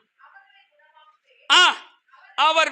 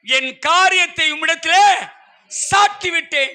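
raised voice, phrase by phrase with long pauses; scripture proclamation; 3 semitones higher, 3 dB louder than usual. Acted. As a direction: declaim the verse firmly to the congregation. (வசனத்தை உரத்த குரலில் நிறுத்தி நிறுத்தி வாசிப்பது)

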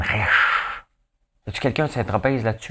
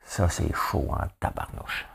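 whispered aside; 3 semitones lower, 7 dB quieter, slower than usual. Real, not acted. Reacts to fifty degrees in Paris.

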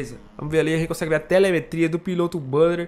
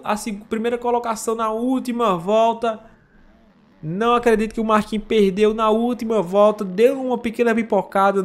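raised, louder race call; excited; 4 semitones higher, 4 dB louder than usual. Real, not acted. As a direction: call the lead change, thrilled. Excited call of last-lap pass.

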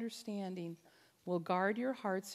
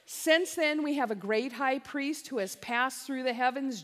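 normal talking voice

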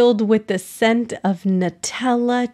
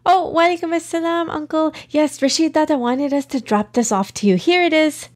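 put-on excited girl voice; 7 semitones higher, faster than usual. Girl answers neighbor.

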